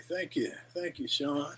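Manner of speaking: usual speaking voice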